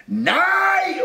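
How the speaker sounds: very high, drawn-out cross yell; grumpy shout. A narrator's reading. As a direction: yell it in exasperation. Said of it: "råbte Rumle surt". (råber surt)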